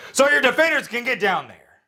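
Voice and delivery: high, loud, forceful restart; loud emphatic redo. Real, not acted. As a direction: hammer the line out clearly. Redoing a fumbled sentence.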